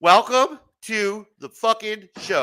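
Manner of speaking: slow, drawn-out host welcome; proper welcome. Acted. Host giving a guest the proper welcome, slow and grand. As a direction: greet the guest like a big announcer. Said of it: "Let's give him the proper welcome".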